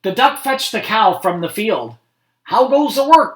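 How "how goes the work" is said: higher and louder farmer call; bossy farmer shouting from bed; lazy farmer calling. A narrator's reading. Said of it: "called the farmer".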